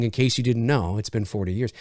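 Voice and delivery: a dropped, quiet mock delivery to the camera; mock line to camera. Actors turning to the camera, mock quiet aside. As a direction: hush it like an aside to the audience. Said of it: "turning to the camera and saying".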